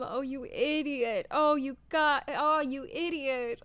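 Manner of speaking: real heartbroken groan at the character; exasperated anguish. Real, not acted. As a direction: scold a fool you pity, near tears. Reader upset at character.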